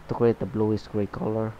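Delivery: ordinary delivery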